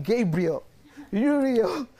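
high, loud mock-desperate calls, breaking into laughter; crying out for angels. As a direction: call out to angels in comic panic, laughing. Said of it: "I cried all the names of angels".

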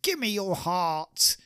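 high, slow pleading demand; desperate demand. Acted. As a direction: drag it out, begging, pitched high. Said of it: "grabs the actor by the chest and said".

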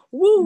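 high, loud "woo" of fake triumph; big sarcastic party whoop; mock celebratory whoop. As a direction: cheer yourself on ironically. Mock cheer at past self.

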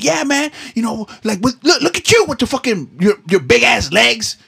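high-pitched, loud mock old man calling out. Old man in a wheelchair calling out to the runner, high and loud. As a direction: yell like a grumpy old man flagging someone down. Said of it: "He was like".

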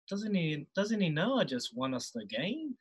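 half to himself, quietly put out; muttered quietly. Retold or imitated. Young player grumbling to teammate Corey Jane, quietly. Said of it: "real quietly".

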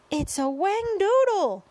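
high, loud, drawn-out yell. A narrator's reading. Head of fire department names the monster, panicked yell. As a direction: yell it out in panic, stretching the name. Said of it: "yelled the head of the fire department".

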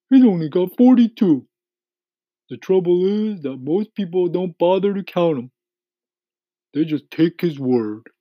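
high-pitched, indignant earthworm, easing as it goes; complaining earthworm. A narrator's reading. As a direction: protest as a put-out storybook earthworm.

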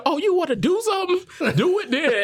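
a hint of a stern sergeant, voiced high and laughing; sergeant's taunt. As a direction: tease it like a laughing drill sergeant. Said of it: "she got in my man's face and said".

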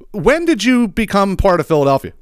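high, indignant "when did you become part"; incredulous Philly rant; exasperated challenge. Real, not acted. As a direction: demand it like an outraged local. Exasperated rhetorical question at suburbs.

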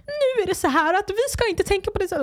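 high, sugary mock influencer calm. Mocking influencers responding to hate comments, high sugary calm. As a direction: play a serene influencer brushing off hate. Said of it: "I wanna be one of those influencers".

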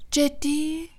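high-pitched sceptical question; lilting, sarcastic disbelief; mock surprise. A narrator's reading. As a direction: feign surprise at a weak excuse. Snape doubts Harry's excuse.

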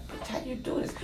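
hushed whisper to a friend. Retold or imitated. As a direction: whisper it under your breath. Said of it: "So I'm like".

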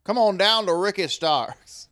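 cheesy eighties car commercial; car salesman pitch. Pretend. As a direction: sell it like a cheesy local dealer ad. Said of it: "like a car salesperson".

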